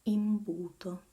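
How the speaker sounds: imbuto modelled loud, with a clear initial "eem"; teacher's loud, careful imbuto: "eem-BOO-to"; pronunciation demo. Shown as a demonstration. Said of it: "we may have words like".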